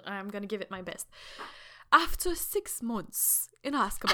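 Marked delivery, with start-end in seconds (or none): 1.9-4.1 slow British documentary narrator; David Attenborough-style British narration; British accent. Shown as a demonstration. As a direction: read it in a measured British accent. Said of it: "vas-y avec ton accent british".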